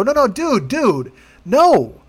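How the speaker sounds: high, exasperated refusal; frustrated no. Retold or imitated. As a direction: shut down the rep's offer. Angry retold phone call.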